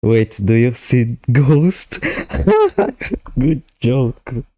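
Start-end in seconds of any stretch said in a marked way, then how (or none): 2.7-4.4 laughing. Real, not acted. Laughs at ghost joke.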